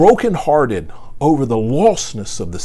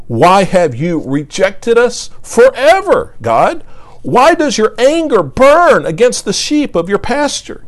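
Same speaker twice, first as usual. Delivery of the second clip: raised, slow, pleading psalm reading; preacher voicing a psalm of complaint, louder and higher; impassioned scripture reading; 6 semitones higher, 4 dB louder than usual. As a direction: read the lament as a cry to God. Reads psalm of lament aloud.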